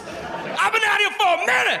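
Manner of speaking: very high, pushy shout at the cops; aggressive witness. Retold or imitated. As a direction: bark it at the detectives. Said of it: "he speaks super aggressively to the cops".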